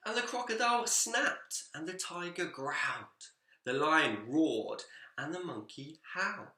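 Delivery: put-on lively, expressive read-aloud for children; story narration. A narrator's reading. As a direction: tell it like a bedtime picture book, bouncing along.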